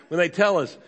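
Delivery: normal talking voice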